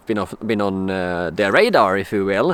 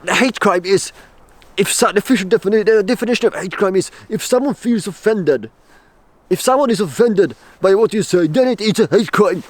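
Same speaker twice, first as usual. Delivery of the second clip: higher mock officer explaining the law; hammed-up officious lecture, higher; 8 semitones higher, 3 dB louder than usual. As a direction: imitate her lecturing, pitched up. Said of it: "she goes on to explaining".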